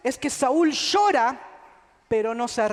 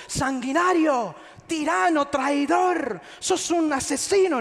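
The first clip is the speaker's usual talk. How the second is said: louder, higher, accusing shout; mock accusation; 4 semitones higher, 4 dB louder than usual. Son denouncing the king, in a hammed-up angry shout. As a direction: hurl the insults like an angry rebel. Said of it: "No le dijo".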